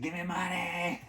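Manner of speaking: high, slow, quiet begging voice; mock homeless lady. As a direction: creep it out like a pleading stranger.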